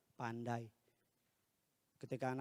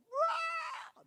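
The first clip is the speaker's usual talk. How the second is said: very high mock crying; imitated child's wail; 5 dB louder than usual. Pastor imitating his child crying downstairs, high wail. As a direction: cry out like a little child. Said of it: "anak saya nangis di bawah".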